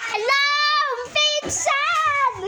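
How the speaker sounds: high, loud, stretched hello to the cousins; loud greeting. Pretend. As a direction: call out the greeting in a sing-song lilt.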